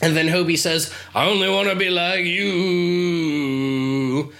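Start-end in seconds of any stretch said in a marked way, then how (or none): 1.1-4.3 slow, drawled nineties grunge singer voice. Pretend. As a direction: drawl it like a lazy grunge rocker. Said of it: "I never pass up an opportunity to do a grunge voice".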